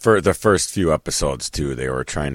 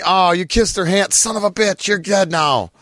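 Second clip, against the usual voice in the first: high, loud despairing cries at a doomed hero; high excited wail; 3 dB louder than usual. Retold or imitated. Fan despairing at Miller's choice on screen, high excited wail. Yelling at TV character.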